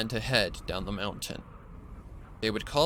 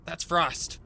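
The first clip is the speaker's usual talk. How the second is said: higher, urgent exclamation; alarmed recognition; 8 semitones higher than usual. Acted. The stallion jumps up at his friend's voice, alarmed and higher. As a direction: blurt it out in sudden alarm.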